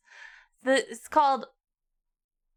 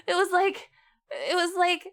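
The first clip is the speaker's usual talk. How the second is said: usual speaking voice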